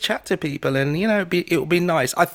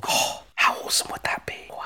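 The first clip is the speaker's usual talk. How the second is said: softer whispered awe; 5 dB quieter than usual. Real, not acted. Replying to the Thanksgiving invite, whispered. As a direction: breathe it out in a whisper.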